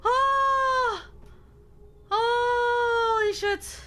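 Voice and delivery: high, drawn-out shocked gasp; startled gasp. Real, not acted. Jump scare by a sound.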